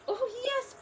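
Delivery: mayor of the speck, grateful; tiny Who voice. A narrator's reading. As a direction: speak as a tiny grateful person. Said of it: "piped the voice".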